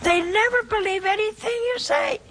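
high-pitched fed-up grumble; exasperated. Real, not acted. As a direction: throw up your hands in frustration. Exasperated complaint.

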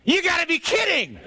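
high holler of disbelief; incredulous shout. Acted. Disciple reacting to his friend in the storm, incredulous yell. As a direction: yell it back, exasperated.

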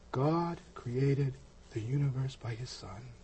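slow, hushed, reverent; solemn and slow. Retold or imitated. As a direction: say it softly, with awe. Quoting friend's solemn words.

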